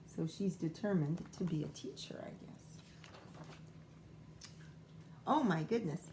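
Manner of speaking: ordinary delivery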